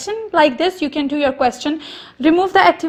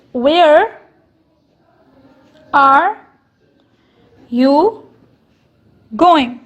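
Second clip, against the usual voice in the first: each word spaced out, falling at the end; slow questioning demo; 5 dB louder, much slower than usual. Shown as a demonstration. Teacher spelling out a question word by word, slow and spaced. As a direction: read it slowly as a question. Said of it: "In this, a question is asked".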